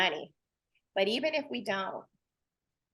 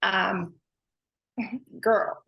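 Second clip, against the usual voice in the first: choked up; 2 semitones lower than usual. Real, not acted. Thanking her boss, choked up with tears. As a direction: voice catching on tears, then a laugh. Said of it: "this is gonna make me a little bit emotional".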